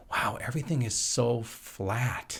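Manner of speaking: soft, slow wonder; quiet amazement. Retold or imitated. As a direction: take it in slowly, under your voice. Retold realisation looking at Wisconsin.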